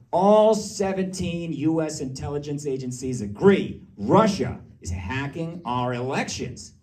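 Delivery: slow, emphatic official proclamation; pounded-in message. Retold or imitated. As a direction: pound each word home like propaganda. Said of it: "they really pounded it to us".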